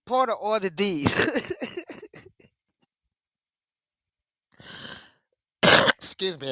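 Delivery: cracking up mid-quote; helpless giggles breaking out after the words; laughing to tears. Real, not acted. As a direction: say it and dissolve into real laughter. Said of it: "Saint Sinner got me crying".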